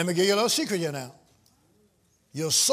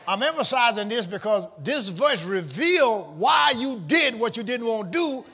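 full-force preaching; 3 semitones higher, 3 dB louder than usual. Real, not acted. Preacher pressing the church on sin, full-force preaching. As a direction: hammer each phrase home to the church.

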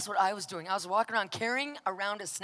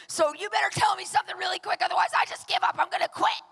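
high, loud, fast threat to quit; desperate ultimatum; 9 semitones higher, 4 dB louder, faster than usual. Retold or imitated. Giving God an ultimatum before quitting, desperate. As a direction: deliver it like a fed-up outburst.

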